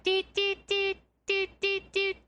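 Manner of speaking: high, evenly plinked single notes; toy piano imitation. Host showing how the piano turns into a toy, high tinny doots. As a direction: sing out tiny toy-piano toots on one pitch. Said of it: "a little Tinker Toy piano".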